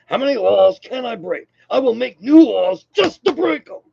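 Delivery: high, loud caricature of a boastful politician; Trump. Acted. Vlogger giving Trump as prime example of lawbreaking, high boastful parody. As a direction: swing way up in pitch and declaim it. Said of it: "Trump, you know?".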